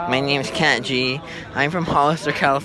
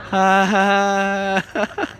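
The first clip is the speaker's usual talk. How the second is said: awkward laugh; 5 semitones higher, much slower than usual. Retold or imitated. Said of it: "she laughs awkwardly".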